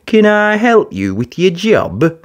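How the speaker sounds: put-on high, eager child-like voice; eager little digger. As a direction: ask hopefully, keen to help. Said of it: "Alfie called out".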